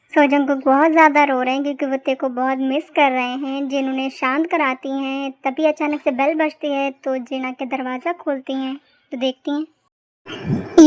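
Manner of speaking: low, soft storyteller's narration; story narration. A narrator's reading. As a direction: tell it quietly, like reading a story aloud. Narrator between character scenes. (धीमी आवाज़ में कहानी सुनाना)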